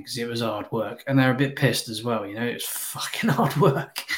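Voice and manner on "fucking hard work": breaking into laughter while talking; laughing. Real, not acted. Man recalling tipsy Geordies to his co-host, laughing. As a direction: let a real laugh burst through.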